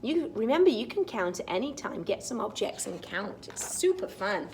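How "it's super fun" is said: ordinary delivery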